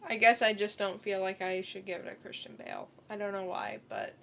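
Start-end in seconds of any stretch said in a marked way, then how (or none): none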